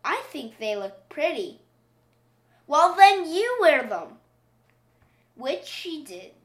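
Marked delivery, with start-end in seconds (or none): 2.7-4.2 louder, cross mom snapping back; exasperated retort. Acted. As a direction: fire it back, fed up. Mother snaps back at daughter.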